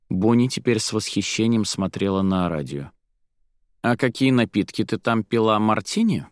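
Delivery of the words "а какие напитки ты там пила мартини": plain reading voice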